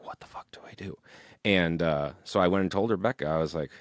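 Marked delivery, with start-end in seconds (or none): 0.0-1.0 whispered to himself in a panic; panicked whisper. Retold or imitated. Panicking about the bassist, he whispers to himself. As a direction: hiss it under your breath, lost.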